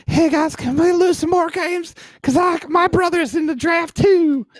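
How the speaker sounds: high, slow put-on kid voice; a high mock voice for Graham Clark; imitating Graham Clark. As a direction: squeak it out like a goofy teen asking a favour. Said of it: "That's what it sounds like".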